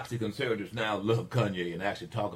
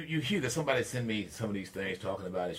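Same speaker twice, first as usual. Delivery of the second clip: usual speaking voice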